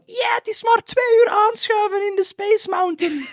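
mocking voice. Imitating eager Disney fans.

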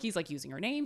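usual speaking voice

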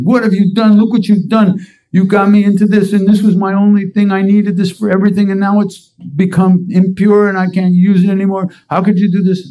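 high, loud furious yell at Maharaji; furious screaming. Retold or imitated. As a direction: scream it like your cup was just ruined. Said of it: "He starts screaming at Maharaji".

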